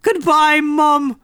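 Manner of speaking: high-pitched comic Irish farewell; put-on Irish accent. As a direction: bid goodbye in hammed-up Irish. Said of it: "my Irish is something to be celebrated".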